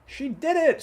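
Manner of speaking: very high, loud screech of joy. A narrator's reading. Hen seeing the cow clear the moon, screeching. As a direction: shriek it out. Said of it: "screeched the hen".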